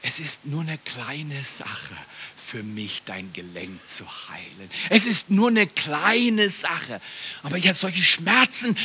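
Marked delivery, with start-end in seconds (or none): 0.0-4.7 slow and low, fading to a whisper; hushed inner voice. Retold or imitated. Preacher voicing the thought God gave him on the floor, hushed and slow. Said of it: "Gott gibt mir den Gedanken". 4.9-7.0 full voice, drawn out word by word; slow emphatic repeat. Retold or imitated. Preacher repeating God's thought to the congregation, slow and emphatic.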